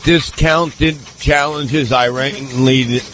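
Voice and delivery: ordinary talk, nothing marked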